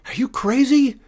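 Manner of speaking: high, loud, incredulous shout; alarmed outburst at a reckless friend. A narrator's reading. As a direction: yell in disbelief, voice shooting up. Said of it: "Alfie shouted".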